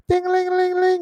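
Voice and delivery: high sung ding-a-ling bell; high bell imitation. Host urging viewers to ring the bell, high sing-song. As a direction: chime like a little bell, way up high.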